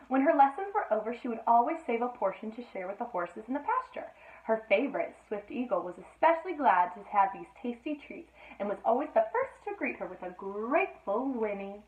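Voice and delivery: lively read-aloud storytelling voice; storybook reading. A narrator's reading. Mother reading a bedtime story to Sophie, lively. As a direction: read the tale to a small child.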